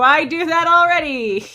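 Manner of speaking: loud, high, teasing repeat; loud mocking echo. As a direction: boast it back as a tease. Echoing friend's boast loudly.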